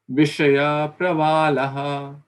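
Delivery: Vishaya Pravalaha said slowly, syllable by syllable; slow careful recitation. Shown as a demonstration. Teacher recites the phrase slowly for students. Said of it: "slow model recitation".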